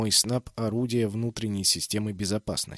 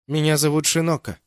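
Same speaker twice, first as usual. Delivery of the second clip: offended; 4 semitones higher, 5 dB louder than usual. A narrator's reading. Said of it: "обиженным голосом ответила база".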